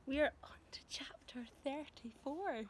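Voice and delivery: usual speaking voice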